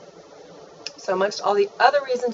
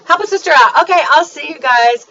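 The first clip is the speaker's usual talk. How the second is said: excited; 6 semitones higher, 11 dB louder than usual. Real, not acted. Cheerful sign-off.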